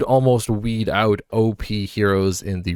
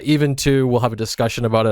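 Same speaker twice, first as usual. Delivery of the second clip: ordinary talk, nothing marked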